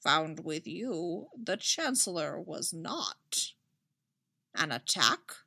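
lightly voiced Yoda, slow and soft; a hint of old Yoda, drawn out; slow, musing little master. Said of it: "voice of Yoda".

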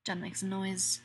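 plain reading voice

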